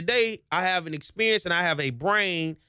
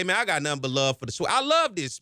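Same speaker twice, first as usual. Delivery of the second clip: ordinary delivery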